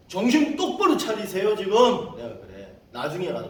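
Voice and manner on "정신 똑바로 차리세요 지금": loud, high scolding command; forceful rebuke. Retold or imitated. Preacher telling cult missionaries off, forceful rebuke. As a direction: hit them with a raised-voice wake-up call.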